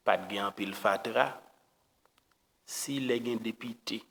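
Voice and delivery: measured rhymed lament, long pauses between phrases; declaimed verse with weighty pauses; spoken-word poem recitation. Acted.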